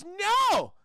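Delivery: high, loud, drawn-out no; emphatic mock cry. Felicia answering the vampire's offer, mock cry. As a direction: wail it in a high put-on voice.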